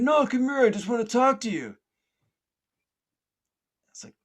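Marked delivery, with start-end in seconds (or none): 0.0-1.8 very high, loud coaxing shout; yelling police officer. Retold or imitated. Police officer urges swimmer to come, yelling. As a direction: coax him over at a holler. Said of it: "I hear somebody yelling".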